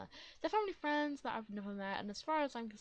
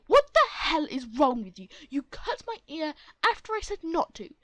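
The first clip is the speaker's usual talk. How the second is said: loud angry protest; angry yell; 4 semitones higher, 15 dB louder than usual. Acted. Bleeding boy yelling at the mother who cut him. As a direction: shout it in outrage.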